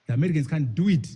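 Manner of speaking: ordinary delivery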